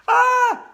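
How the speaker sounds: very high loud terrified scream. Acted. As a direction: scream in terror. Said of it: "I screamed".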